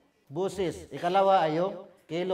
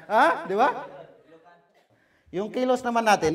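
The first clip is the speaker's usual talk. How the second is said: ordinary talk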